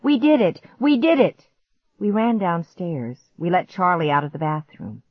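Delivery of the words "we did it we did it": high, loud jubilant chant; triumphant raised voice; celebrating. A narrator's reading. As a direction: whoop it in celebration. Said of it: "jumped up and down in celebration".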